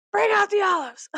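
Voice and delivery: high, bright blurted stim; vocal stim shout. Shown as a demonstration. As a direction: yell it out of nowhere, half asleep. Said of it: "I'll just be like".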